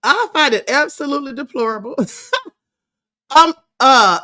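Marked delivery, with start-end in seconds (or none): 2.0-2.5 short high laugh; amused laugh. Real, not acted. Host scoffing at a deplorable video, amused laugh. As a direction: let a quick, high laugh slip out.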